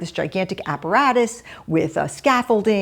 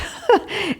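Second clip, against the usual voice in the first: short light laugh. Real, not acted. Laughs while recalling calling Elizabeth.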